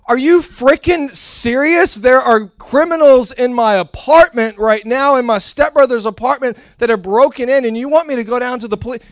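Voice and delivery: hammed-up fury at being sent to the station; indignant shout, half acted; retold outraged yell. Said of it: "I did not have the guts to yell at a police officer".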